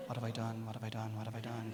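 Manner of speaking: low, soft muttered dread; quiet horrified self-talk; quiet panic. Retold or imitated. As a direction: mutter it to yourself in rising dread.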